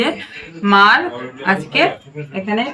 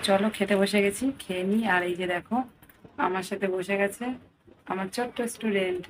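much softer, hushed so as not to wake anyone; speaking softly; 10 dB quieter than usual. Real, not acted. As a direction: keep it down, everyone's sleeping. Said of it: "ওর জন্য আস্তে আস্তে কথা বলছি".

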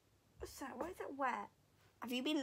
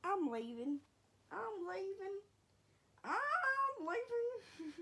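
dog voice; 6 semitones higher, 5 dB quieter, much slower than usual. Pretend. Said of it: "She's like".

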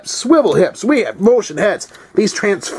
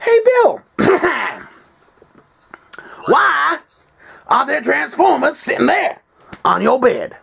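mock viewer voice; 8 semitones higher than usual. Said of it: "you're probably wondering".

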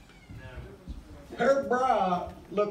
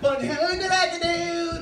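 mocking showoff; 8 semitones higher, slower than usual.

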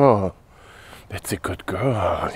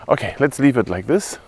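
ordinary delivery

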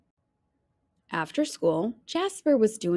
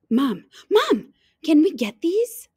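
put-on high, eager begging child; pleading kid; 7 semitones higher, 5 dB louder than usual. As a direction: beg Mom with a bright pleading lift. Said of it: "Jasper pleaded".

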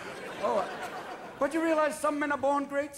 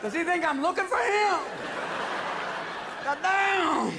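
high, indignant outburst; 6 semitones higher than usual. Retold or imitated. [name]'s indignant reaction.

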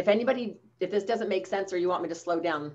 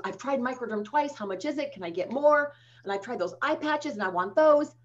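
higher-pitched eager customer quote; excited customer; 6 semitones higher than usual. Retold or imitated. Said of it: "get so excited about a gift".

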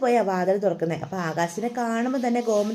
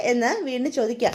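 ordinary delivery

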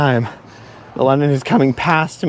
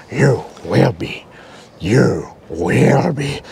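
lower, slow, croaky little Jedi master; a wise old Yoda warning, lower and slow; Yoda impression; 7 semitones lower, much slower than usual. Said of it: "It's Yoda".

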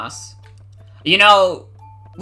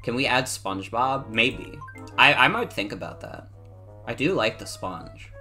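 usual speaking voice

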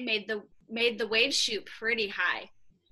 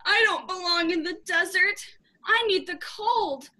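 higher, louder, tearful-sounding plea; mock sobbing plea; 7 semitones higher, 5 dB louder than usual. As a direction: act out a sobbing penguin. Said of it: "began to cry as she sobbed".

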